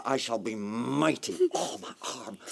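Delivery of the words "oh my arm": soft, hissed groan of pain; injured man whimpering about his arm; pained whisper. Acted. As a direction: clutch the hurt arm and breathe it out. Hurt arm, crying out.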